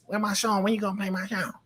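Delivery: high-pitched imitation of a bold woman. Bold girl confronts the DJ about her song, mock bold. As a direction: put on a high, sassy demanding voice. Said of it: "she a little bit bold".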